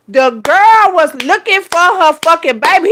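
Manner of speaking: loud, high, drawn-out indignation; indignant emphasis. Retold or imitated. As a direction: stretch it out loudly in exasperation. Repeats line with force.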